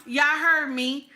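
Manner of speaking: ordinary delivery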